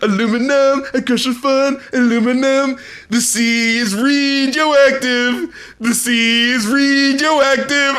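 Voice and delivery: a hint of the rapper's odd drawn-out accent, slow; odd rapper delivery. Mocking the track's rapper, slow and drawn out. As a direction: stretch radioactive slowly, mock rapper. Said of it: "Why are you saying it like that?".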